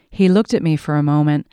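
usual speaking voice

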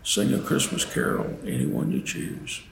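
ordinary delivery

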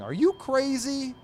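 high, loud, drawn-out indignant cry; hammed-up comic-strip indignation; accusing outburst. Dagwood accusing the mailman, loud mock outrage. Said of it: "pointing an accusing finger at the mailman".

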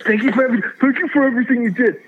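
high, fast gushing thanks; gushing grateful fan. Retold or imitated. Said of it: "Thank you for your service".

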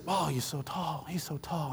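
very soft, slow amazed whisper; hushed awe. Retold or imitated. Shopper overheard in the store, hushed awe. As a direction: murmur it in wonder from the aisle.

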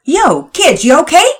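high, loud casual call across the yard; friendly neighbor calling out. A narrator's reading. New neighbor calling to kids across the yard, loud casual hail.

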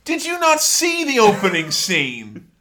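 hammed-up disgust at snakes; mock Indy freaking out; exasperated Indy. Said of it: "And Indy's like".

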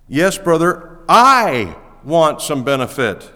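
I punched loud and drawn out slowly; preacher leans hard on the word I; stress on I. Shown as a demonstration. Preacher shows Paul's emphasis to the congregation, stress on I. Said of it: "Circle I if you have a pen".

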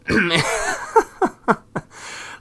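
high-pitched burst of laughter; real laugh. Real, not acted. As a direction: crack up at your own joke. Laughs at own joke.

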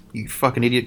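usual speaking voice

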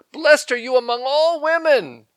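high, loud, ringing blessing; joyful praise. Retold or imitated. Elizabeth greeting Mary, high and loud with joy. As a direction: sing out the blessing over her. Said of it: "praised her and said".

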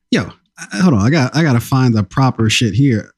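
ordinary talk, nothing marked

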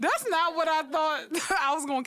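high, laughing disbelief; mock shock. Hearing her sister's cold reply, high and laughing. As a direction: laugh through the surprise.

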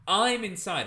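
ordinary delivery

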